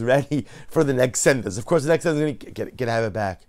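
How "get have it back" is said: normal talking voice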